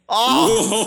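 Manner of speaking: a high, loud gasp of delight; excited exclamation. Real, not acted. Hearing the curling score, a loud, excited oh. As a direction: let the surprise burst out loud. Australia leads Canada seven-nil.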